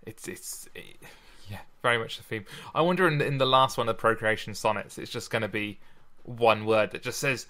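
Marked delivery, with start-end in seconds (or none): none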